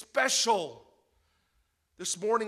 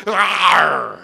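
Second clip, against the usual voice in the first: loud growling pirate shout; pirate arr; 14 dB louder than usual. Retold or imitated. Pastor shouting a pirate cry to the congregation. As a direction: bellow it like a pirate. Pirate party on Disney cruise.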